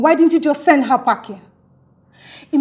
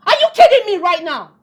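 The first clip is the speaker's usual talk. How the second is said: higher, louder disbelief; indignant outburst; 5 semitones higher, 7 dB louder than usual. Acted. Caterer offended by her friend's criticism, indignant outburst. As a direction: hit back, offended.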